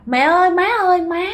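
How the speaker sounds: high-pitched imitation of calling for mother; acted-out daughter's call to mother; retold calling out. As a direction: call after her, voice lifted. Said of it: "mẹ cứ kêu rằng".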